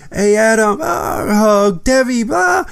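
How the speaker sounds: high, sing-song imitation of a breezy hello; mock girly greeting. Imitating Alisa breezing past him, high and mock-bright. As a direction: mimic her airy, dismissive hello. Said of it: "and she go".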